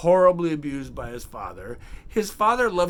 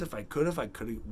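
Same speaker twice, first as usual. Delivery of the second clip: usual speaking voice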